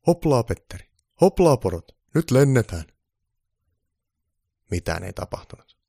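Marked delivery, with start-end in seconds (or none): 0.1-2.8 high, loud call to the reindeer; rousing driver's shout. A narrator's reading. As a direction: urge the team into flight. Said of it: "Pukki istahti rekeen ja huusi".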